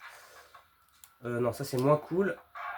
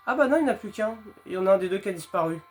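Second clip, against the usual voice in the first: surprised gamer; 5 semitones higher, 4 dB louder, faster than usual. Real, not acted. Monster suddenly gone.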